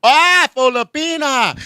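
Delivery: high, slow, loud dawning realization; realizing aha. Retold or imitated. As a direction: land the aha moment.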